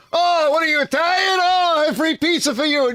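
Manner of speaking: excited pizza man. Retold or imitated. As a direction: shout it like a thrilled pizzeria guy.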